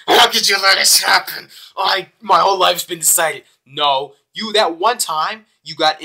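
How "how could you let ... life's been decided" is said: mock angry. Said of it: "Misaki's getting so mad".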